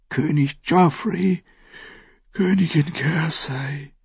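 put-on high sleepy girl voice reciting names; sleepy girl's prayer. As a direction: list the names like a tired prayer. Arya recites her names prayer.

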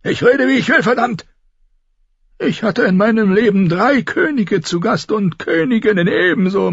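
put-on foul-mouthed old lord berating his bastard son; cranky old lord. A narrator's reading.

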